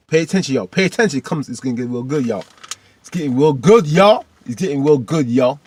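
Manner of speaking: hyped. Real, not acted. Vlogger hyping viewers before the finale, excited and loud. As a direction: build excitement, rising.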